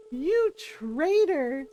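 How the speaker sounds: drawn-out, slow mock betrayal; mock accusation. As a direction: stretch it out, playfully wounded. Ron mock-scolds the dog.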